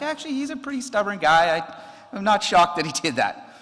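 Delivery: amused resigned aside. Retold or imitated. Retelling his own thought, amused.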